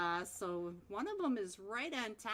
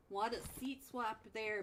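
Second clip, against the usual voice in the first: ordinary talk, nothing marked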